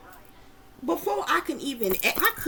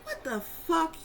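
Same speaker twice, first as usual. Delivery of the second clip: high, baffled exclamation; 5 semitones higher, slower than usual. Retold or imitated. Telling the viewers about the dream man, a high baffled exclamation. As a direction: squeal it out in disbelief.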